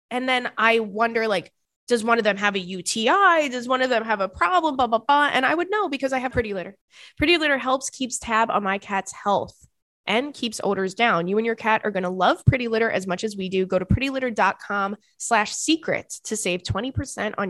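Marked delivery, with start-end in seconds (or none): none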